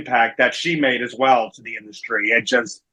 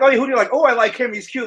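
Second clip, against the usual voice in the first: put-on high, giddy fan-girl voice, much higher; mock gushing mom; 10 semitones higher, faster than usual. Mom gushing to her son about a cute wrestler, giddy high voice. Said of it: "I'm like".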